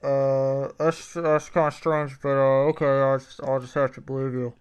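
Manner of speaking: burnt-out fast food worker voice; drive thru speaker. Acted. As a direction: talk like a bored drive-thru employee. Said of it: "why don't you do that this whole episode".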